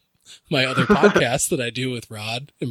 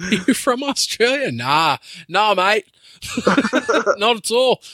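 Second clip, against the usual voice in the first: playful fake Australian accent, laughing through it; mock Australian accent; 6 semitones higher, slower than usual. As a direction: ham up an Aussie denial with a laugh. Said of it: "a whole Australian accent".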